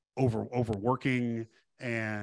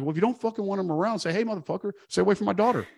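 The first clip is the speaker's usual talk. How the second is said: higher, fast, blunt pep talk; fired-up advice; 8 semitones higher, 3 dB louder, much faster than usual. Retold or imitated. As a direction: rattle it off fast and pitched up. Coaching the father bluntly.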